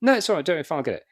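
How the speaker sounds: high, bright no-worries refusal; polite refusal. Retold or imitated. Star brushing off the runner's tea offer on day two. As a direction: wave it off cheerfully.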